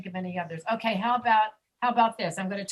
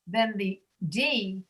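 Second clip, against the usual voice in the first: normal talking voice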